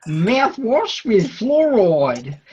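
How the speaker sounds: very slow, every word drawn out; slow teaching read; slow demo. Shown as a demonstration. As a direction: spell out "Mouthwash with fluoride" slowly.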